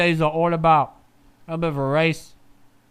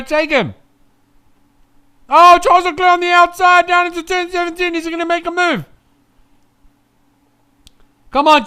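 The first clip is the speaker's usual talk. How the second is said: excited cheering; 4 semitones higher, 7 dB louder than usual. Real, not acted. Cheering driver to overtake.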